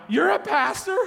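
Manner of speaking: high, loud incredulous question; stunned disbelief. Retold or imitated. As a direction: sound floored by the news. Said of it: "I said, "Yeah".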